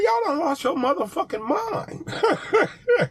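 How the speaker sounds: high, slow, laughing outrage at "Y'all done lost your motherfucking mind"; laughing disbelief. Retold or imitated. Vlogger mocking the late fee charge, high and laughing. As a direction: laugh through it in mock outrage. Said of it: "I'm like".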